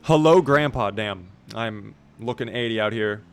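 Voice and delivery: ordinary delivery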